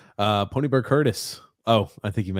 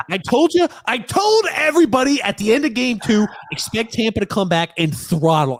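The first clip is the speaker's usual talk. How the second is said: high, loud triumphant gloat; 11 semitones higher, 4 dB louder than usual. Real, not acted. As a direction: crow about being right. Gloating over correct prediction.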